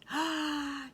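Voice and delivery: high, astonished intake of breath; delighted surprised gasp; amazed gasp. Acted. Piglet amazed at compliment.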